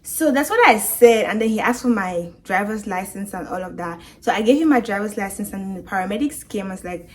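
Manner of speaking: plain reading voice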